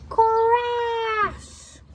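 high, drawn-out "craaash"; dramatic crash noise. Acted. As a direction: stretch the crash out as a sound effect. Voices the tree crashing down.

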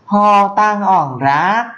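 "Ho tăng ỏng rác" cao và rất chậm; đọc tách từng tiếng, giọng cao; Mường phrase, slow. Shown as a demonstration. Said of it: "thì là". (câu Mường cao, rất chậm)

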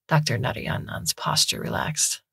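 ordinary delivery

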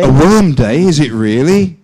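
loud, high, teasing query; mock disbelief. Teacher querying the student's slip, mock disbelief. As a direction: echo it back incredulously, loud and high.

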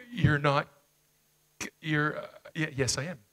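normal talking voice

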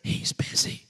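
put-on little boy's hushed whisper. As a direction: whisper secretly into the phone. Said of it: "came back on the phone whispering".

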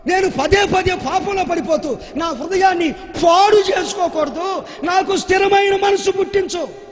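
high, loud impassioned plea; preached prayer of a repentant king. Retold or imitated. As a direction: cry out to God for a new heart. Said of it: "పశ్చాత్తాపం చెందుతూ".

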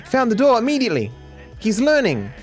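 high, startled exclamation; excited disbelief at an enemy's move; alarmed amazement. Real, not acted. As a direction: react with sudden high-pitched surprise. Enemy finds door, alarmed exclamation.

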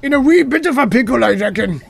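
high-pitched mock-Scottish voice; Scottish accent. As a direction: report trouble as a high-voiced Scot. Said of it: "if it was a Scottish person in space".

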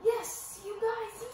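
high, loud happy yell; excited shout. Real, not acted. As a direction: cheer like you just won. Finally got shield.

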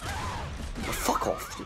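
high yelp of surprise; startled outburst. Real, not acted. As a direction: cry out in shock. Killed unexpectedly, outburst.

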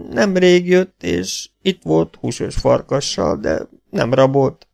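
high, dragging, halting speech; weak injured voice. A narrator's reading. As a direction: answer slowly, labouring over each phrase. Injured half-breed answers haltingly.

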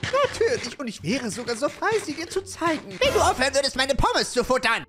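high, prim fairy scolding; sniffy fairy telling off a snacker; fairy voice with a hint of scolding; haughty fairy. Fairy scolds fry thief.

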